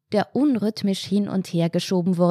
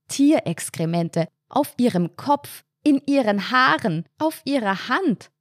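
higher-pitched, revolted listing; horrified disgust; 6 semitones higher than usual. A narrator's reading. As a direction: let each place add to the disgust. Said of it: "Marianne schauderte". (angeekelt, mit höherer Stimme)

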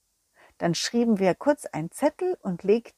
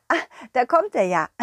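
usual speaking voice

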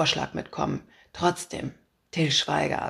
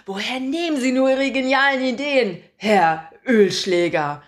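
loud, higher, fawning praise; butter him up shamelessly; over-the-top flattery; 3 semitones higher, 6 dB louder than usual. Acted. Reporter fawning over her boss so he hangs up.

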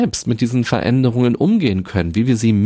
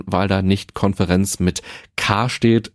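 ordinary delivery

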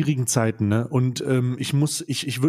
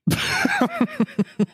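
high-pitched laugh. Real, not acted. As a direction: burst of high squealing laughter. Joke about SC Freiburg stadium.

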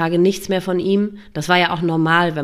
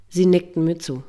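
plain reading voice

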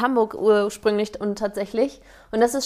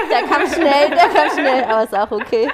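laughing it out, a bit louder and higher; 4 semitones higher, 5 dB louder than usual. Real, not acted. Host laughing at the guest's quick answer, amused. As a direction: chuckle through the quip.

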